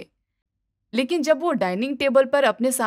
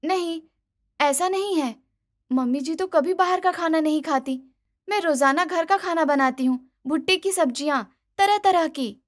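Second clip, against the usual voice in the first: higher, innocent protest; defensive daughter-in-law; 6 semitones higher than usual. Acted. Daughter-in-law to doctor, defending: high, innocent. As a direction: naive bahu denying.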